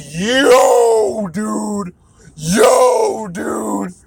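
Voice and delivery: high, loud hyped Yo dude; wrestling bros. Retold or imitated. Wrestling dudes in the room hyping her up, loud yells. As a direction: holler it like excited bros. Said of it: "the other wrestling dudes ... were like".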